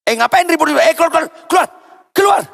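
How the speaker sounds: high, loud scolding shout; acted irate assistant yelling; angry ordering out. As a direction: chase off a noisy intruder. Acts assistant throwing parent out.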